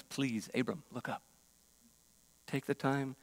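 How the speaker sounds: God gently urging Abram to look up; tender coaxing. Retold or imitated. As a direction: coax him softly to look up. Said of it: "tenderly and compassionately and patiently".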